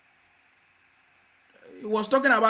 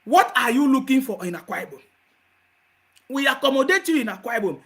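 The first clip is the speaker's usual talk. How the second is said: normal talking voice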